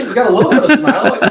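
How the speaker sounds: high giggly laughing. Real, not acted. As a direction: laugh it out, delighted. Laughing at the guest's smile.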